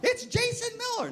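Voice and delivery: very high, mocking kid's announcement; mock squeaky student voice; dreaded caller. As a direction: squeal the name as a nightmare. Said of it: "Now I think I'm having a nightmare".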